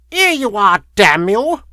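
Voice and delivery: high, loud crone snarl. A narrator's reading. Woman handing over the letters to the lawyer, snarling. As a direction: snarl it out, giving in angrily. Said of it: "she snarled".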